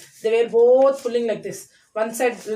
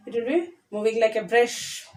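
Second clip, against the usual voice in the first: ordinary delivery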